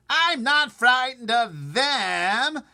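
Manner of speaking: very high, loud, slow defiance; shouted. Acted. Centipede shouting his bravado at the others. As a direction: shout it out, drawn-out. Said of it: "shouted the centipede".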